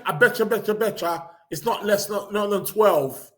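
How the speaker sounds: normal talking voice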